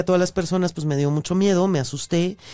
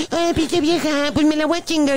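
high mock thug voice; 9 semitones higher than usual. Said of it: "empezó así como que a decir".

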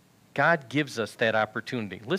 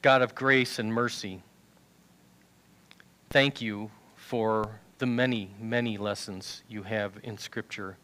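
prayer; 3 semitones lower, 4 dB quieter than usual. Real, not acted. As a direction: pray quietly before the congregation. Preacher leads the closing prayer.

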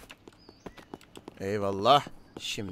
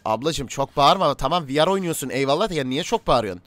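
raised-volume complaint at a customer; loud remonstrating; 7 dB louder than usual. Acted. Cafe owner to a shouting VR player, loud remonstrating. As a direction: tell her off loudly, over the noise.

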